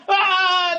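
high scream of a fleeing man; panicked scream. Retold or imitated. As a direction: yelp and bolt. Driver flees again.

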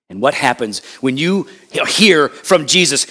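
ordinary talk, nothing marked

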